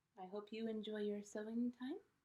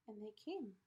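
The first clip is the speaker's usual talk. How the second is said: plain reading voice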